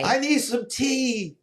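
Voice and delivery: high, slow put-on British voice; drawn-out hammy Englishman; ordering tea in a terrible British accent; bad British accent.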